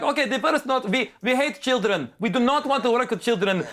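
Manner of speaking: high, hammed-up German accent delivering notes; mock German accent. German director giving script notes, mock German accent. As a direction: bluntly lay down demands in a thick German accent. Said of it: "directors sound like Hans and Franz".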